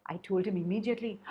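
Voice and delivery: usual speaking voice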